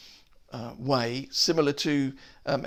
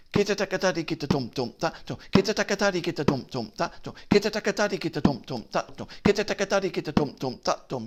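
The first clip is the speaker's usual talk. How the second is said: quick syllables, pitched up; fast rising syllables; 3 semitones higher, much faster than usual. Shown as a demonstration. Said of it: "the syllables would change".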